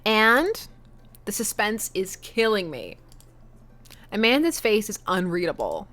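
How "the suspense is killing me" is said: normal talking voice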